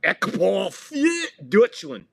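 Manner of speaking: slow, loud German announcement; mock German announcer. Commentator saying corner kick the German way, hammed up. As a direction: announce it like a German stadium speaker. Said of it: "Or as they like to say".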